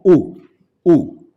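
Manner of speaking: U as one pure oo, firmly repeated; read as U. Shown as a demonstration. Teacher models how to read the diphthong, firm pure U. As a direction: punch out U twice as a single vowel. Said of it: "lo vamos a leer como".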